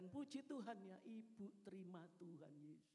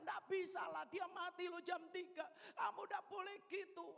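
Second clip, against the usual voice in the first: louder, higher alarmed shouting; urgent shout; 8 semitones higher, 6 dB louder than usual. Retold or imitated. Preacher shouting at her sister that the woman will die, urgent.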